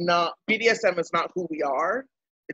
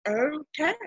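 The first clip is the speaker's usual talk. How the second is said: bemused; 9 semitones higher, 3 dB quieter than usual. Retold or imitated. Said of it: "And I was like".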